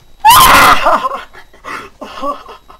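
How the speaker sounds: high, loud shrieking laugh. Real, not acted. Laughs after dying.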